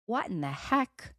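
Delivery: ordinary talk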